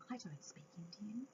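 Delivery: whispered, confiding admission; whispered aside. Real, not acted. Aside to audience.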